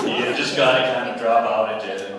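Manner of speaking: loud, put-on American accent. Shown as a demonstration. Actor describing audition courses, in an American accent. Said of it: "to learn the American accent".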